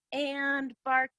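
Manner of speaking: ordinary talk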